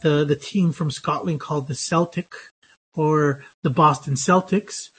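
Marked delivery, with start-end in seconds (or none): 1.8-2.3 "Celtic" said SEL-tik, as for the team; soft S. Shown as a demonstration. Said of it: "going to be pronounced with an S". 3.7-4.9 "Celtics" said SEL-tiks; soft S. Shown as a demonstration. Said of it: "going to be pronounced with an S".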